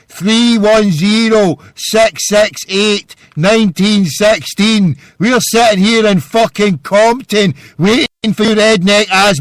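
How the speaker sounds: high, loud ranting shout; belligerent challenge. Real, not acted. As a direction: yell it out, stretching the words. Angry challenge to redneck callers.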